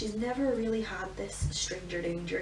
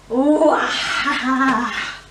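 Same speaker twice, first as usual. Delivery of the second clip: delighted laugh; 4 semitones higher, 12 dB louder, much slower than usual. Real, not acted. Mother laughing with toddler.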